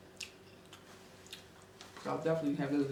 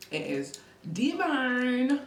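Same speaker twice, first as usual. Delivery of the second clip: high, slow, louder drawn out divine; imitating a catchphrase; 8 semitones higher, 5 dB louder, much slower than usual. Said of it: "Like the Eats would say".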